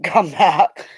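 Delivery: ordinary talk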